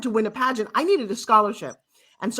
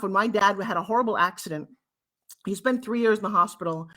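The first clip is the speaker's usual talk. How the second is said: ordinary talk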